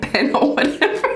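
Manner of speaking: breaks into a high laugh on "or whatever"; giggling through the end of the mock quote. Real, not acted. Tarot reader laughing at the teasing she just quoted, laughing.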